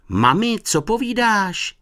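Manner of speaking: high, drawn-out call; shouting. A narrator's reading. Honzík calls to mum through the window, shouting. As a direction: shout to someone out of earshot. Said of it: "křičel Honzík".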